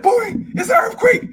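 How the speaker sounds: high, loud mom calling her son; urgent mom. Retold or imitated. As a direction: call your boy to safety, urgently. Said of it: "She said".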